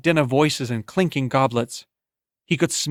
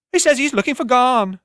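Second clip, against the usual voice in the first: high, loud and ringing like a bell; a voice that floats above the tavern noise; clear ringing woman's voice; 11 semitones higher, 4 dB louder than usual. Acted. Tavern woman calls out over the crowd, high and ringing.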